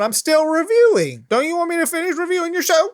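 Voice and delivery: high-pitched, mock-indignant objection; playful protest. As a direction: protest at being interrupted, pitch jumping up. Protesting to an interrupting character.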